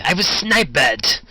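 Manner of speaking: outraged. Acted. Outraged at losing auction.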